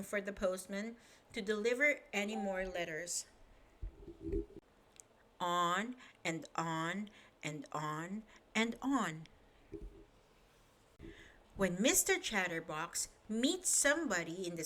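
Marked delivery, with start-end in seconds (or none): 5.4-9.2 slow, repetitive drone; droning on. A narrator's reading. Narrator mimicking endless chatter, slow drone. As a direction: drag the words out.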